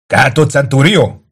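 slow, high, loud call; shouted down. A narrator's reading. Tullius down from the palisade to Kato, shouting. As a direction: hail an officer from above. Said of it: "kiáltott le Tullius a cölöpfalról".

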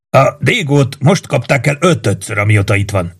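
plain reading voice

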